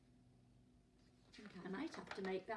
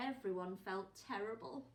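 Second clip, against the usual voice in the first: ordinary delivery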